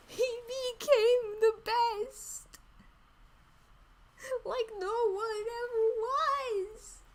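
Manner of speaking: high, slow hammed-up crying; mock sobbing. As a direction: wail it like fake tears. Continued fake crying.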